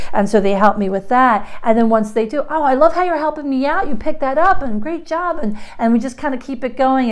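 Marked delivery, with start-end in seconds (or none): 2.5-5.4 put-on high, bright praise to a helping child; positive praise. Shown as a demonstration. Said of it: "back to that positive language".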